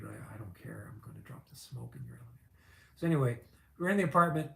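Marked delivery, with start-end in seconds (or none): 0.0-2.5 mock-indifferent whispered aside, much softer; careless whisper. Retelling [name]'s don't-care attitude in a soft whisper. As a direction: hush it out like you couldn't care less. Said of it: "he just had that attitude".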